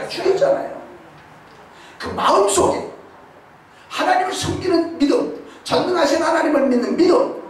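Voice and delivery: high, fervent preaching; 높고 뜨겁게 외치는 설교; preaching at full force. Acted. Preaching climax. (믿음을 강조하며 열정적으로 설교한다)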